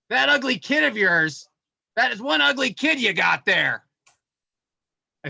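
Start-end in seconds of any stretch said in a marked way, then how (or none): 0.1-3.8 1 mock clown insult. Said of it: "He goes".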